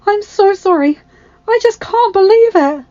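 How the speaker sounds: high, loud, gushing apology; overwhelmed with emotion. A narrator's reading. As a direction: pour it out to a long-lost friend. Teary-eyed young woman greets her friend.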